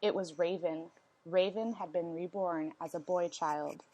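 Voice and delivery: plain reading voice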